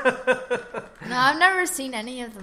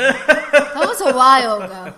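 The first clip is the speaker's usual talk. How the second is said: laughing; 3 semitones higher, 13 dB louder, slower than usual. Real, not acted. Laughing loud reply.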